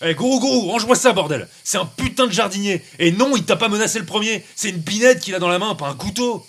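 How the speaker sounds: high, exasperated orders; urgent scolding. Acted. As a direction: rein in a dangerous friend, urgently. Urgently restrains armed companion.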